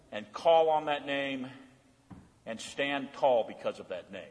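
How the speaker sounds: ordinary delivery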